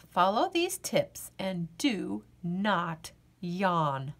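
plain reading voice

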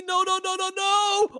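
normal talking voice

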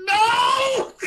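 high, loud anguished yell of no; a player's cry as his assistant is shot; inner scream. Retold or imitated. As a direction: scream it inside as she's shot. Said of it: "But inside the whole time, I'm just like".

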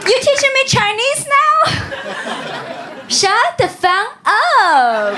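mock indignation. Snapping back at correctors.

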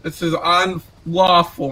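usual speaking voice

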